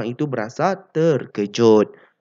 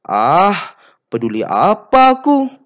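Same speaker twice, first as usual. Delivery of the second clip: louder, higher scoff of not caring; dismissive; 6 semitones higher, 8 dB louder than usual. Shown as a demonstration. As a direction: toss it off like you couldn't care less.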